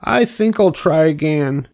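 high, chirpy pig voice; cheerful. A narrator's reading. Pig decides to try again, cheerful. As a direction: bounce back as a cheerful little pig. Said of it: "said Wilbur cheerfully".

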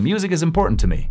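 rapid connected speech; super fast. Shown as a demonstration. Teacher repeating the sentence for learners, super fast. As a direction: say it very fast, words linked. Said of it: "Así súper rápido".

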